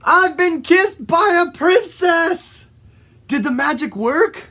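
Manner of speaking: hammed-up excited frog; thrilled shout. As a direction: cry out that your wish came true.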